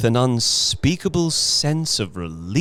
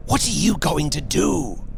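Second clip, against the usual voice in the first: put-on urgent, hoarse old man's voice, raised pitch; hoarse whisper; 5 semitones higher than usual. A narrator's reading. Husband holding his wife back from the door, hoarse whisper. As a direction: ask hoarsely, in a strained stage whisper. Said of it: "he whispered hoarsely".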